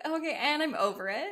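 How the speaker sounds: mock kid brush-off. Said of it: "You're like".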